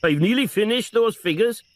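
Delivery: put-on high lilting Welsh accent, rising on nearly; sincere sing-song Welsh voice. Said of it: "sing-song Welsh voice".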